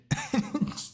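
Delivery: breathy, voiceless wheeze of laughter; incredulous laugh. Real, not acted. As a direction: let out a short disbelieving wheeze. Laughing at the absurdity.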